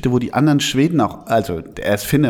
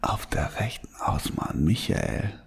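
whispering; 8 semitones lower, 11 dB quieter than usual. Real, not acted. Repeats announcement, trying to recall.

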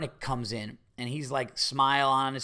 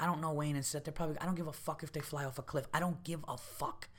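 confidential aside; 11 dB quieter than usual. Retold or imitated. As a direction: lean over and grumble softly. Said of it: "I leaned over to Giannis".

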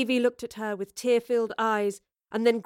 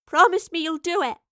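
loud, high call over a din; raised, pleading shout; 8 semitones higher, 6 dB louder than usual. A narrator's reading. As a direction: call out urgently over the noise. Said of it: "Evie called above the mounting wail".

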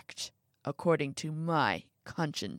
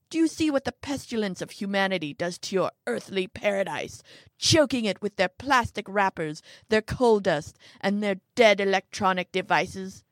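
angry tirade; 5 dB louder than usual. Acted. Old man rants about humanity.

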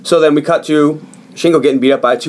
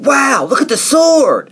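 high, drawn-out mock amazement; awed excitement; 12 semitones higher, slower than usual. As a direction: marvel like an awestruck kid, pitched way up. Acting out boys finding the sword.

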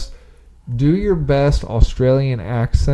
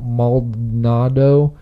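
normal talking voice

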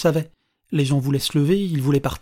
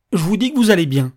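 emphatic declaration; 4 semitones higher, 6 dB louder than usual. Acted. Old man declares she is well.

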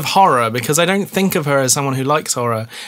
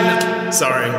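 normal talking voice

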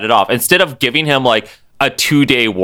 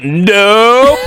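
mock Omega Red; 9 semitones higher, 5 dB louder than usual. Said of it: "He's like".

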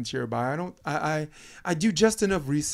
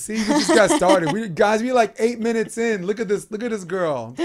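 louder, higher teasing; playful tease; 7 semitones higher, 10 dB louder than usual. Real, not acted. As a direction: tease the guest loudly. Host teasing as she undresses.

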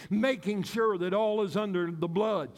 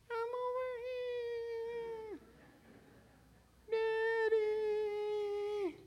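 put-on much higher, soft sing-song little child; 11 semitones higher, 9 dB quieter, much slower than usual. Small child hinting to daddy from behind the curtain, sing-song. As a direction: sing it out like a hiding toddler.

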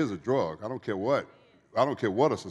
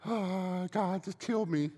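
mock crying; 10 semitones higher, slower than usual. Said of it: "I'm sitting over there crying".

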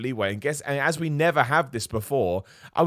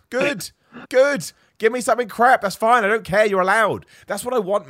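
excited; 9 semitones higher, 7 dB louder than usual. Real, not acted. Ranting, welcoming anything crap.

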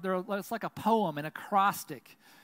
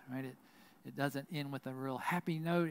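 usual speaking voice

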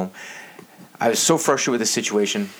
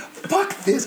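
frustrated outburst. Retold or imitated. Throwing Coke-spraying bag in frustration.

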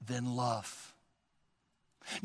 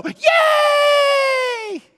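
put-on high, loud childlike cheer; a squealing kids' yay; kids cheering; 8 dB louder than usual. Kids thrilled about seeing Jesus, high loud cheer. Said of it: "And the kids go".

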